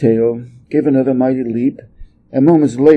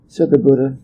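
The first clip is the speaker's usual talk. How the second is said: plain reading voice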